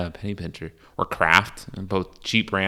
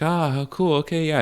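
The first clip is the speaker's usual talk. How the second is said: higher, politely fake enthusiasm; feigned friendliness; 8 semitones higher than usual. Responding to someone's fist bump, high feigned enthusiasm. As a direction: humour them with put-on approval. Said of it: "And you're like".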